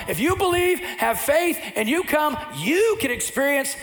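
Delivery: high, loud passionate preaching; full-force preaching. Acted. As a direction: preach it out to the whole room.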